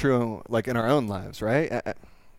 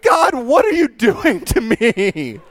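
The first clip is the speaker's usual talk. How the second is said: higher, slow, loud plea to God; hammed-up cry to the heavens; dragging it out in mock despair; mock exasperation; 7 semitones higher, 4 dB louder, slower than usual. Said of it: "And I was like".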